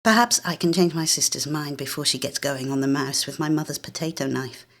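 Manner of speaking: ordinary delivery, nothing marked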